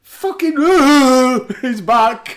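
high, very loud whoop of excitement; excited outburst. Acted. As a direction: yell it like a thrilled fan. Mock excitement at wrestler's return.

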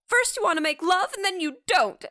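high-pitched quoted complaint; hammed-up quarrel line; mock exasperation. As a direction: imitate an exasperated lover. Acted lovers' quarrel from film.

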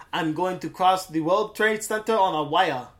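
mock an actor's French accent; badly faked French English; bad put-on French accent. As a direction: imitate a terrible French accent. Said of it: "trying to sound like a".

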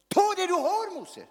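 loud, high preaching cry; preacher at full force, voicing God; God calling out. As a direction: shout it like God urging Moses. Preacher voices God challenging.

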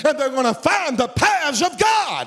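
rising into a full preacher's cry; preaching climax. Real, not acted. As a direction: lift it high for the congregation. Sermon climbs to a climax.

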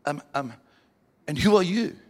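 mock shock bursting into a question; shocked stammer. David questioning the old man, stammering in shock. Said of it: "He was so shocked he went".

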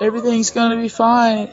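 high, loud serene reassurance; calm pacifist voice. Retold or imitated. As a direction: chirp it like nothing's wrong. Acted quote of calm pacifist.

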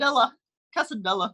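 "Quesadilla" with English L sounds, as "kessa-DILLA"; anglicized pronunciation. Shown as a demonstration. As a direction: mispronounce "Quesadilla" to rhyme with "vanilla". Anglicized mispronunciation as worst example.

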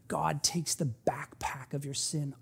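slow, soft whisper; hushed assurance of forgiveness. Real, not acted. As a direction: whisper it tenderly to the congregation. Tender close to congregation.

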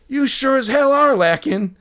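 high, loud mock roast. Ballplayer roasting the unprepared fan, high and loud. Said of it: "he totally roasted me".

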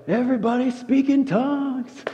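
a hint of a hyped-up worship leader; pastor's call. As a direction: mimic the pastor calling out over the music. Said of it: "He's like".

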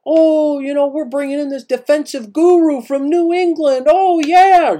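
high, loud mock-excited fan gushing. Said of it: "people got all excited".

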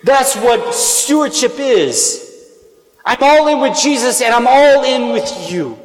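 full-force preaching. Acted. Priest challenging the parish to give, full-force preaching. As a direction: preach it out to the whole church.

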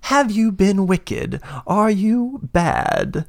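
high, eerie creature voice, slowing on the last words; gleeful menace from behind bars; caged horned elf slams the bars, high eerie taunt; monstrous taunt. Acted. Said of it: "he slams against the cage, and he goes".

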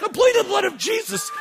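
very high shouted prayer; shouted plea. Retold or imitated. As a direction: cry out the plea at full force. Preacher battling demon, shouting.

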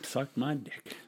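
soft, low "suck my dick"; hushed, suggestive demo; sexual undertone. Shown as a demonstration. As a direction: drop it low and intimate. Shows sexual reading of the phrase.

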